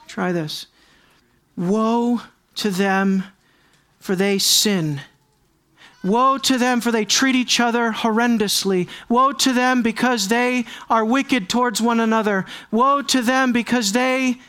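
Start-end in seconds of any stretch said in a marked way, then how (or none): 1.6-5.0 drawn-out, weighty lament; slow solemn reading. Shown as a demonstration. Preacher showing the congregation how to read the verse, slowly. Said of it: "let's say it like this". 6.0-14.4 building proclamation. Shown as a demonstration. Preacher reading the woes aloud to the congregation, building. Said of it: "let's say it like this".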